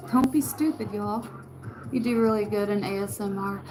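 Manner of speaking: ordinary talk, nothing marked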